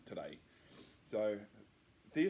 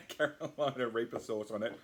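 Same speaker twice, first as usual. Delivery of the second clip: laughing; 4 dB louder than usual. Real, not acted. Laughs while describing.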